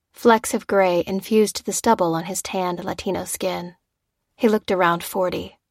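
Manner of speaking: plain reading voice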